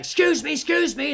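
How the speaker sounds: high, loud mock panic; imitated urgent plea. Woman rushing out to the toilet, urgent. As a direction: act out someone pushing through in a hurry.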